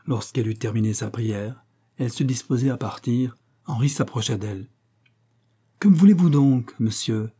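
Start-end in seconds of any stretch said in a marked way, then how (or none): 5.8-7.3 higher, louder outburst on the question; startled cry. A narrator's reading. Young woman to the man approaching her, crying out. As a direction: cry out in mild alarm, easing on the last word. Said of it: "s'écria la jeune fille".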